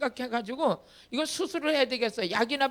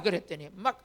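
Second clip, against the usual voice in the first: ordinary delivery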